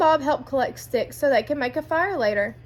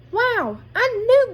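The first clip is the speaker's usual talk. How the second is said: high, louder triumphant shout; thrilled exclaim; 10 semitones higher, 6 dB louder, slower than usual. A narrator's reading. Bob sees the Bigfoot note and cries out in triumph. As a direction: crow it with delight.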